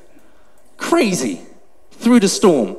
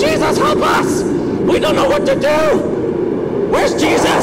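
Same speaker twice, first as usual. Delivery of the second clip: high-pitched acted screams for help; panicked screaming; slower than usual. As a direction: scream in mock panic over the storm. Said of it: "They're screaming".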